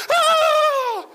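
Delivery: very high, loud shriek of joy; excited shriek. Retold or imitated. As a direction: let out a thrilled scream. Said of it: "and she goes".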